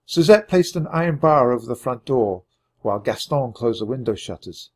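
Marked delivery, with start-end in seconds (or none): none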